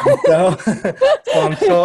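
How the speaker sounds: high, loud, giggling; laughing. Real, not acted. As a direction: laugh with delight. Guest laughing with joy.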